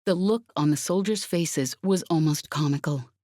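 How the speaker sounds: plain reading voice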